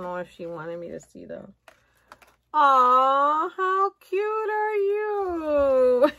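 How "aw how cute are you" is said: high, loud, slow coo; cooing delight. Real, not acted. Opening her bestie's gift, loud slow delighted coo. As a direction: stretch out the coo, melting over it.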